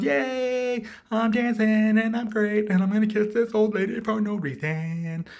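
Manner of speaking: high, loud, drawn-out singsong; hammed-up prancing entrance voice; mock glee. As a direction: stretch it out like a gleeful show-off. Acts out gleeful dancing entrance.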